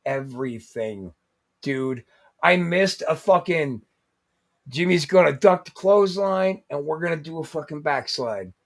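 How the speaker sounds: ordinary delivery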